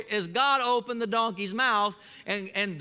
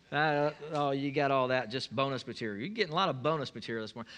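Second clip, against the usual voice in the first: ordinary talk, nothing marked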